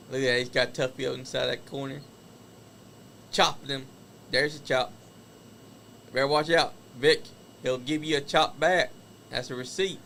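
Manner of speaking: live play-by-play wrestling call; wrestling commentator. Acted. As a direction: call the match as it happens. Calls the wrestling match live.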